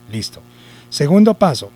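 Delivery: ordinary talk, nothing marked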